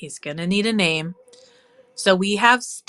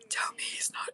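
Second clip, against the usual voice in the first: whisper; 12 dB quieter than usual. Real, not acted. Whispering adoration.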